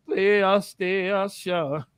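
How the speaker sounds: laugh, then PlayStation very slowly; laughing drawn-out PlayStation. Acted. Host laughing at his own bit, dragging out PlayStation. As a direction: crack up and drag out PlayStation. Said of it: "coming right with the foolery".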